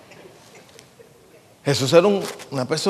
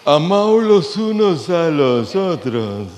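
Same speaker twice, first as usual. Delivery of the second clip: movie Jesus voice; much slower than usual. Retold or imitated. Mocking how films portray Jesus, slow affected parody voice. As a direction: parody the Mexican-film Jesus, slow and precious. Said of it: "él habla así como de lado".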